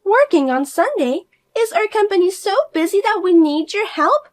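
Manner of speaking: high, loud, incredulous sneer; mock the very idea she works; scoffing sister-in-law. [name] sneering at her sister-in-law's job, high and incredulous.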